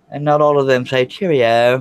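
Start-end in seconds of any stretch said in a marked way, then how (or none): 1.1-1.8 raised, loud British lilt; hammed-up British; mock British accent. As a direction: toss it out in a playful British accent. Mimicking British people saying cheerio.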